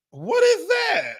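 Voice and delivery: put-on a teasing shocked shout at the old phone; mock disbelief. Colleague sneering at his old phone, shocked high shout.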